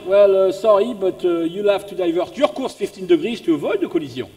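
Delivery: put-on hesitant but insistent French-accented reply; French radio operator politely standing firm. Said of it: "The French".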